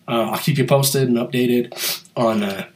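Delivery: stuffy nose from a cold; nasal voice. Real, not acted. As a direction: talk through a blocked, nasal nose. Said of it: "nasally voice. I've got a little bit of a cold".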